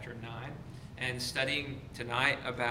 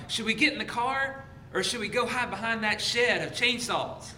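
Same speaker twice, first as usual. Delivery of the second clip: higher-pitched mock urgent question; panicked kids deliberating; 8 semitones higher than usual. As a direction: voice kids frantically weighing a bad plan. Acted kids fleeing a killer, deliberating.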